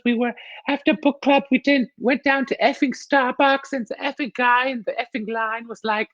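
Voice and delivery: put-on high, German-accented mom voice; comedian's mom impression. Comedian acting out mom's book club story, high mock-mom voice. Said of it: "So she would be like".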